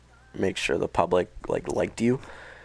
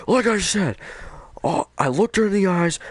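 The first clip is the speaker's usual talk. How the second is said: high flustered mock panic; 12 semitones higher than usual. [name] repeats, flustered.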